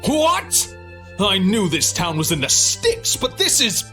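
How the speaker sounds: high-pitched outraged protest; indignant outburst. Acted. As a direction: blurt out your outrage, pitch shooting up. Snobbish guest refused a room.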